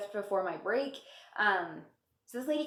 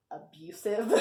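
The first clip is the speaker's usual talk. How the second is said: ordinary talk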